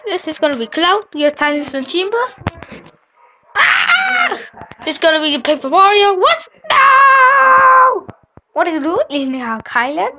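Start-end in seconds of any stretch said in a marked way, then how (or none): 3.6-4.4 sudden high yell of dismay; shocked yell. Real, not acted. Character loses in the game. 6.2-8.1 loud, high protest; dismayed shout. Real, not acted. Upset at an elimination.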